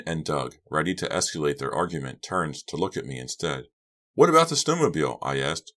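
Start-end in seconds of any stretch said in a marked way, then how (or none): 4.2-5.2 quick, high, loud suggestion; excited. A narrator's reading. Proposing the snowmobile to the others, excited. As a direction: pitch it up, eager and fast. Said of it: "excited by my thought".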